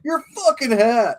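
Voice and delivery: high, slow mock-irate New Yorker; stretched New York insult; hammed-up New Yorker picking a fight; New Yorker coming out. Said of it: "your true fucking New Yorker coming out".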